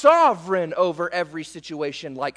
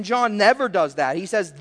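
ordinary talk, nothing marked

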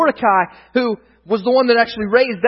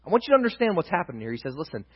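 usual speaking voice

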